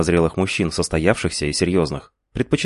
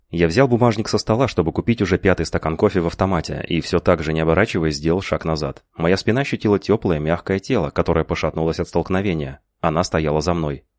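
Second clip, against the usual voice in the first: usual speaking voice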